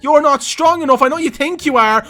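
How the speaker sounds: loud, high-pitched trash talk; gleeful taunting. Real, not acted. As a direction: gloat loudly at the beaten defender. Gloating at beaten defender.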